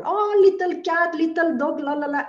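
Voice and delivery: high, sing-song talk to a pet; mock baby talk. Healer mimicking owners cooing at pets, high and teasing. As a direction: coo at a kitten dismissively. Mocking how we see animals.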